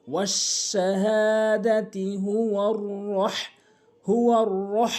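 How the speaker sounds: slow, drawn-out chanted recitation; Quran recitation. Shown as a demonstration. Teacher reciting the verse slowly for students.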